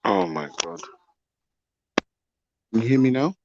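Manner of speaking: usual speaking voice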